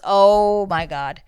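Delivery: big drawn "Oh", then falling back; loud, appalled outburst; loud exclamation. Real, not acted. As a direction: belt out the "Oh" in dismay. Exclaims at the dramatic interruption.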